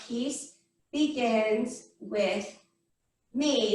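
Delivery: slow, low, spaced-out mantra; measured chant, word by word; meditation mantra. Shown as a demonstration. Teacher leading finger-tapping meditation for the class, slow mantra. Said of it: "Goes like this".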